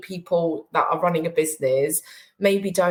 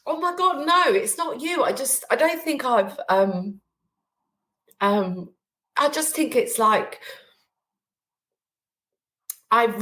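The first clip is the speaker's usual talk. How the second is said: choked up; 5 semitones higher than usual. Real, not acted. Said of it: "I'm just getting emotional".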